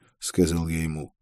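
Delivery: usual speaking voice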